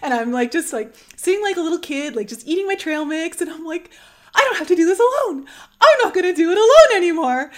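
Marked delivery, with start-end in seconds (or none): 4.4-7.5 high, loud, rushed and elated; excited declaration. Retold or imitated. Alone while peaking, repeating it loud and elated. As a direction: blurt it out in joy.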